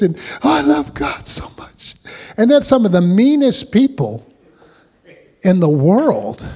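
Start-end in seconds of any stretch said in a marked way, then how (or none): 0.4-2.0 high, overdone "Oh, I love God" that swells then drops to a hush; mock gushing churchgoer. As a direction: parody a showy worshipper, half crying out, half sighing. Mocking showy churchgoers' gushing.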